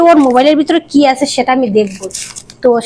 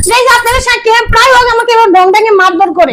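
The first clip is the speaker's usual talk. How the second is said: indignant complaint; 7 semitones higher than usual. Real, not acted. Complains to viewers about slap.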